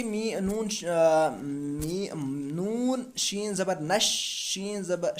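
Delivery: slow letter-by-letter spelling of नश; teacher's slow drill reading. Shown as a demonstration. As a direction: spell it out slowly for the students. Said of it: "तो हम पढ़ेंगे".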